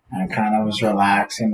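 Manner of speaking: ordinary talk, nothing marked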